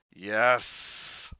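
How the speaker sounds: Yes hissed long with three S's; drawn-out yes. Acted. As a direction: stretch the S in Yes. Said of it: "It says yes with three S's".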